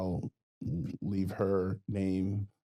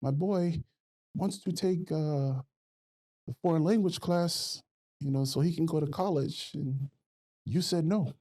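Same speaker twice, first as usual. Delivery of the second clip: higher-pitched imitation of a mom's thick Samoan accent; 8 semitones higher than usual.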